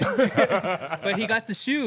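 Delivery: high, loud and slow, laughing it out; real laughter over the punchline. Real, not acted. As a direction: crack up while saying it. Laughing at Luke's story.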